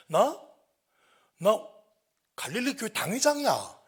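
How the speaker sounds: loud, high boast; mock-important declaration; indignant self-announcement. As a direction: pull rank at full voice. Imagined boast to the teenagers.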